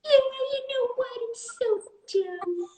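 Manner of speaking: very high silly dog voice; squeaky, drawn-out put-on voice; voicing the dog. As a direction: speak for a dim little pet in falsetto. Speaking for the dog Winnie.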